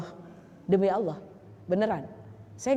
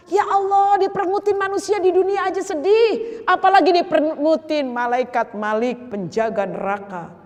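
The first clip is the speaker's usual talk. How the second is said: fervent preaching; 6 semitones higher, 3 dB louder than usual. Real, not acted. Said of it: "preaching at full force".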